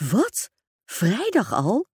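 high, shocked, slowing on the second question; girl startled that her stepmother leaves so soon. Acted. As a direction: caught off guard by the date. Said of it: "riep Tempera verschrikt".

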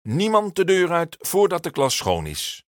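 high, loud firm order; stern teacher's loud command; calling out. A narrator's reading. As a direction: block the door with your voice. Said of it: "roept meester Jaap".

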